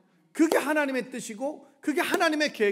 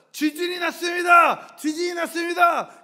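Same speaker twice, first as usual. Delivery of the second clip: high, loud alarm shout; urgent announcement; 8 semitones higher, 8 dB louder than usual. Acted. Preacher acting out earthquake news, urgent shouting. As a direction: call it out like an emergency alert.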